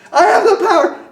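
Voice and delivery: very high, loud yell; retold scream. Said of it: "and so I screamed it".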